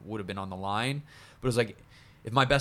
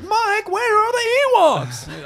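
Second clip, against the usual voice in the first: much higher, louder, slow whine; Jerry Seinfeld impression; 13 dB louder, slower than usual. A kid asking his brother Mike, in a Jerry Seinfeld voice. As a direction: whine it like a sitcom comic. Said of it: "Stop doing your Jerry Seinfeld".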